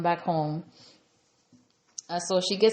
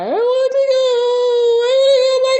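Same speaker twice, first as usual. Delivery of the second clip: very high, loud mock wail; hammed-up crying, wailing the words out; Yasmin; 11 dB louder than usual. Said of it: "she starts to cry".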